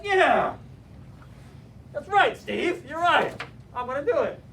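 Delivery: excited agreement. Retold or imitated. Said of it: "I was like".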